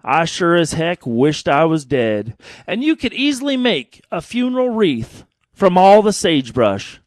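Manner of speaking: lively storytelling cowboy poem; cowboy poem recitation. Acted. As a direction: recite it like a campfire yarn.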